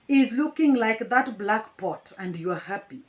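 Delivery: ordinary talk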